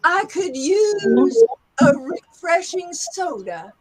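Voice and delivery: high, slow Southern drawl; Southern accent. Shown as a demonstration. Teacher repeats for students, drawn-out Southern accent. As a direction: stretch "use" and "soda" in a Southern accent. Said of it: "let's go to the Southern accent".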